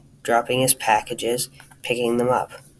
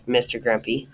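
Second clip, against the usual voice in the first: ordinary delivery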